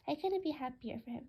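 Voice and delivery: usual speaking voice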